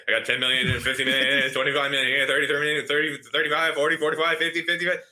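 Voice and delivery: fast, rising auctioneer patter. Retold or imitated. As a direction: rattle off bids like an auctioneer. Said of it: "It, it was like an auctioneer".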